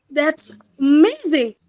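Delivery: exaggerated tune demo, pitch peak in "amazing"; rise intonation. Shown as a demonstration. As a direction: swing "amazing" up and back down. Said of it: "for rise intonation".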